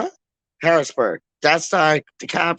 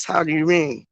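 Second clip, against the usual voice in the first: usual speaking voice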